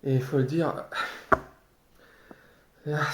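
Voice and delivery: ordinary delivery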